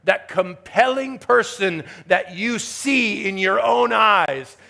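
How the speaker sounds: forceful sermon delivery, leaning on key words; emphatic preaching. Real, not acted. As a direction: preach it with rising and falling emphasis.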